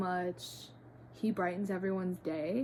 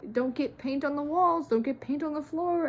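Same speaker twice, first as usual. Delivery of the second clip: higher, louder mock scolding; scolding parents; 7 semitones higher, 6 dB louder than usual. Parents warning a child about paint, mock scolding. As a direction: imitate a nagging parent's warning.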